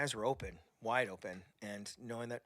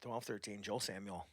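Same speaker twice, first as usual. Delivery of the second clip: choking up; 7 semitones lower, 11 dB quieter, slower than usual. Real, not acted. Remembering Joel Samuel, quietly near tears. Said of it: "Now I'm gonna cry".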